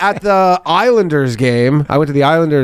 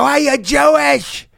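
high, slow lisped shout out a bus window; lisping call; 12 semitones higher, slower than usual. Retold or imitated. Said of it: "they have this weird lisp".

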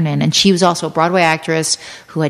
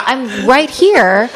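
mock exasperation; 6 semitones higher, much slower than usual. [name] to director staring out at nobody, exasperated. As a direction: call for attention with drawn-out words. Her retort to director looking away.

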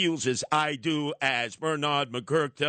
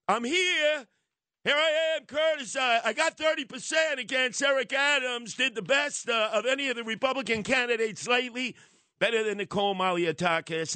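mock candidate voice; 7 semitones higher than usual. Speaks as rival candidate.

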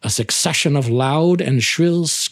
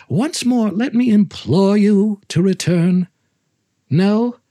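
higher, louder mock plea; mock pleading; 10 semitones higher, 5 dB louder than usual. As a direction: beg him with false concern. Montresor mock-implores chained friend.

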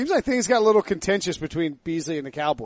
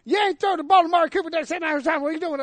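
a wild, off-target Jerry Jones voice; bad Jerry Jones impression; 12 semitones higher than usual. Owner chewing out his coach over play calls, high mock yell. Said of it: "I don't even know what that accent was".

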